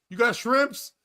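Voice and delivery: demanding customer. Retold or imitated. Woman asks waitress for shrimp, mock demanding. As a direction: act out a pushy moviegoer's order. Acting out loud moviegoer ordering.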